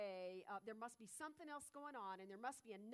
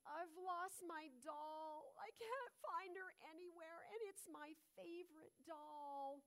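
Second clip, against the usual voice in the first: a hint of a sad little girl, slow and higher; lightly voiced little girl; 6 semitones higher, slower than usual. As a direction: gently play the upset child who lost her doll. Little girl tells Kafka her loss.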